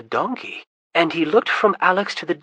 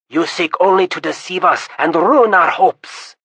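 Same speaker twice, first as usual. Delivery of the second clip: loud angry outburst; yelled; 3 semitones higher, 8 dB louder than usual. Acted. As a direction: shout down the wizard. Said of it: "Kappa yelled".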